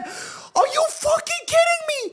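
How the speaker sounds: very high, drawn-out shriek of disbelief; furious fan outcry; outraged cry. Real, not acted. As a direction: scream it in disbelief at the ref. Outraged at no penalty.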